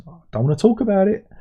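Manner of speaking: mock voice. Mocking people who ignore news.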